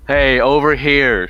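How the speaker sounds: loud, high, drawn-out call; yelling. Acted. Assisting an ally by luring the target over, yelling. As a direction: call out to draw someone over. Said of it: "by just, uh, yelling".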